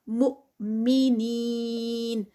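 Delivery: مؤمنین with the long ee held four counts, slow; four-count stretch. Shown as a demonstration. Teacher chanting the word for students, stretched. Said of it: "ہمزہ ہے stop پر".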